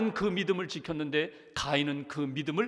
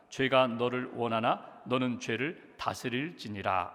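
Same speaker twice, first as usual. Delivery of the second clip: lower, measured Bible reading voice; scripture reading; 6 semitones lower than usual. Acted. Pastor reading Genesis to the congregation, solemn reading. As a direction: read the verses solemnly, slowing at the end.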